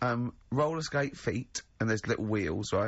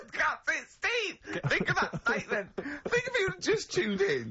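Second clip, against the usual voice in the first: squeals high through laughter, much higher; cracking up, pitched way up in disbelief; laughing disbelief. Real, not acted. Laughing disbelief at Carl's claim.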